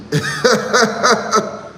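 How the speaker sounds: a bright burst of laughter; real laugh. Real, not acted. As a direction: laugh at the absurdity. Laughing at own point.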